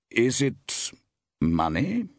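halting question with a pause midway; tentative. A narrator's reading. Producer asking the actress about money, tentative. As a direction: feel your way toward an awkward question. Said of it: "rather tentatively".

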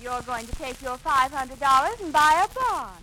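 ordinary delivery

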